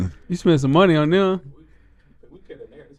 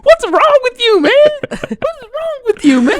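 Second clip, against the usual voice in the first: imitating friend. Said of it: "I'm crying 'cause".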